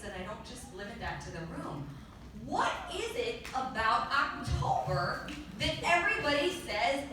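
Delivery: ordinary talk, nothing marked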